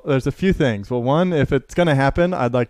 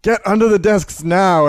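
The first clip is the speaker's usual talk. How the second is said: louder, higher yelled order; retold yell; 8 semitones higher, 6 dB louder than usual. Teacher yelling at the class in an earthquake, retold. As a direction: call out an urgent command, re-enacted. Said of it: "And I basically yelled".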